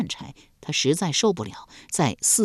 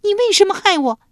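high, loud cry of pain and accusation; anguished demand; 7 dB louder, faster than usual. Acted. Scalded girl cries out at the old maid, high and loud. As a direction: scream the question through the burn. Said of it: "捂着脸朝老宫女质问道".

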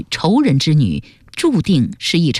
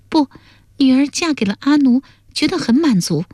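put-on high-pitched young wife; contented daughter; 9 semitones higher than usual. Daughter defending her marriage to her father, high-pitched sincerity.

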